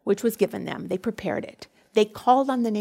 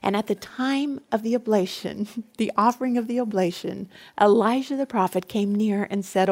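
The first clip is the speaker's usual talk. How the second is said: usual speaking voice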